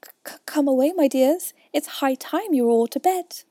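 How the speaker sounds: high, loud, stammering mother bird; trembling call. A narrator's reading. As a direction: call the children away, voice quavering. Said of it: "called out in a trembling voice".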